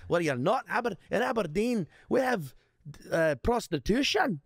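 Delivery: high, hammed-up Scottish accent; bragging onstage in a mock Scottish accent. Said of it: "get up there and be Scottish".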